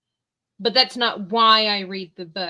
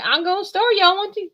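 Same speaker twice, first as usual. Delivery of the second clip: much higher country twang; 10 semitones higher, 5 dB louder than usual. Retold or imitated. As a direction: ham up a rural Southern accent. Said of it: "And he's like".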